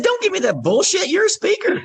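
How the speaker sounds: high-pitched mock scolding; animated retold rebuke; scolding insistence. Imitates client brushing off doubt.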